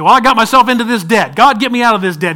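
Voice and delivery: mock desperate borrower; debtor's plea. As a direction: beg God to fix the debt.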